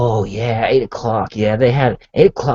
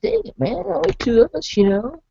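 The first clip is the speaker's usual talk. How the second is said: cheerful high voice. Retold or imitated. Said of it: "Is that the only two of them?".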